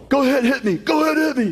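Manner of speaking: a squeaky dare to be hit; high mock taunt. Preacher mimicking Rocky's taunt in a high squeaky voice. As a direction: pitch it way up, teasing.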